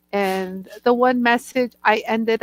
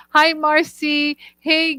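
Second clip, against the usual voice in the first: usual speaking voice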